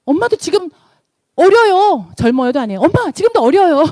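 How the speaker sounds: high, loud, insistent daughter voice; acted-out daughter's high-pitched pep talk; daughter cheering mom on. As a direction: urge mom on like an excited daughter. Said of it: "우리 딸이 하는 말".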